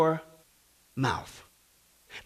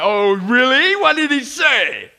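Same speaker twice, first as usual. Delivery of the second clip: loud, high, drawn-out mock interest; sarcastic; 6 semitones higher, 8 dB louder, much slower than usual. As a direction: sneer out the question slowly. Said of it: "hairdresser's sarcastic reply".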